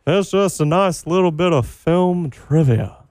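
folksy sign-off in a cartoon Texan voice; mock Texan drawl. Returns to the Texan voice.

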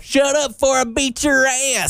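high, hammed-up country twang; mock country threat. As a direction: threaten in a put-on country accent. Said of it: "I was like".

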